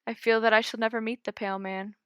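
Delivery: plain reading voice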